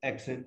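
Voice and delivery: "accent" said low and soft with no stress; "accent" dropped quietly, unstressed. Shown as a demonstration. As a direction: say "accent" evenly, neither syllable punched. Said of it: "no stress".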